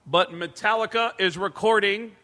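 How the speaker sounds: loud, booming arena announcement; stage announcer. Retold or imitated. Comedian posing as band staff addresses the crowd, announcer voice. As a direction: address a stadium crowd with authority.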